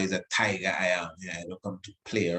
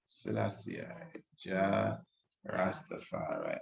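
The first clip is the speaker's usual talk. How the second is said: hushed, whispered invocation; whispered praise; 3 semitones lower, 10 dB quieter than usual. Real, not acted. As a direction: breathe the names like a prayer. Whispered devotional chant.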